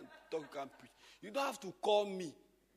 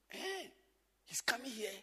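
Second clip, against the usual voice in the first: usual speaking voice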